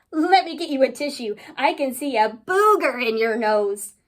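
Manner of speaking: ordinary delivery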